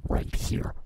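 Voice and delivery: hushed whisper of the plug line, "Right here" with no voice; whispered echo. Acted. Show plug echoed in whisper.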